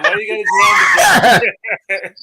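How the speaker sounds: loud, high indignant shout; mock outrage shout. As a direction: shout it in disbelief. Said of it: "He was like".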